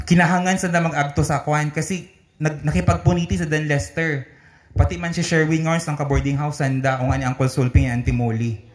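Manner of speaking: ordinary talk, nothing marked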